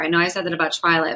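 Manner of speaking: ordinary delivery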